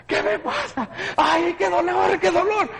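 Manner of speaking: high, slow pained wail; crying in pain. Retold or imitated. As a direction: plead for help through the pain. Calling for help in pain.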